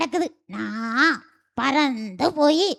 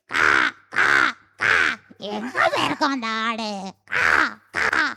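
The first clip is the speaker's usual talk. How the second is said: crow caw; 4 semitones lower than usual. Acted. Crow cawing as it flies.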